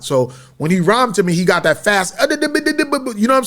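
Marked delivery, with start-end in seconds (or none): none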